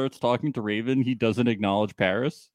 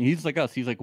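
usual speaking voice

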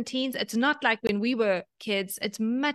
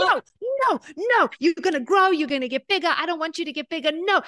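high, loud playful no no no; mock protest; 4 semitones higher, 5 dB louder than usual. Mother teasing picky eaters, high and loud mock protest. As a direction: tease them like you're begging them not to eat. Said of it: "and I'd be like".

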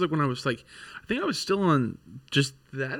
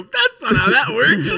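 laughing, slow and high; 10 semitones higher, 8 dB louder, slower than usual. Retold or imitated. Quoting Gio, cracking up and high. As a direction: say it through laughter. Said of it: "all I hear is Gio go".

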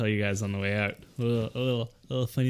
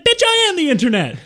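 high, loud, outraged retort; indignant shout; 8 dB louder than usual. Retold or imitated. His indignant retort quoted.

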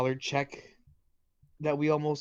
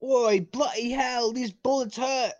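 mock British accent; 8 semitones higher than usual. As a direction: whine like a hit redcoat. Acting a shot British soldier.

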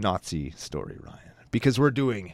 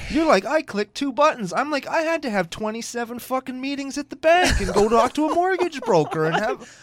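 high whiny rant; 9 semitones higher, 4 dB louder than usual. Real, not acted. Mock rant at cohost.

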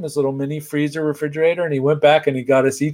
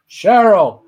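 higher, louder call across the room; 9 semitones higher, 7 dB louder than usual. Retold or imitated. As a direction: summon the assistant across the office. Jerry calling his assistant.

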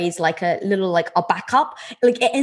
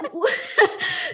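short laugh; 10 semitones higher than usual. Real, not acted. Laughs at her own idea.

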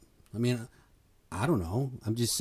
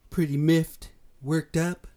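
higher, louder, worked-up burst; agitated; 6 semitones higher, 5 dB louder, slower than usual. Real, not acted. Vlogger admitting anger at the news, higher and louder. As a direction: let the agitation spill out. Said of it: "It's really got me agitated".

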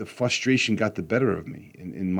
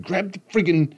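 higher, exasperated order; frustrated; 11 semitones higher than usual. Retold or imitated. Dad ordering son during garage door fix, frustrated. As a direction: bite down on friggin' in irritation. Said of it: "I was very frustrated".

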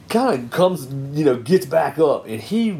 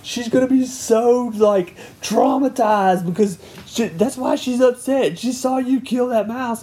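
higher, reproachful voice; scolding wife; 11 semitones higher than usual. Retold or imitated. Wife scolding dad for killing the mouse, a hint of high reproach. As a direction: lightly voice the upset wife.

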